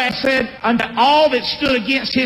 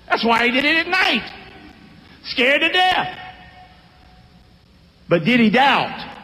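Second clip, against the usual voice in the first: ordinary talk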